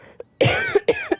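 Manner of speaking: a loud, sudden startled cough; embarrassed cough. Acted. Caught out by Paimon, the adept coughs. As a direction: cough loudly to dodge the question.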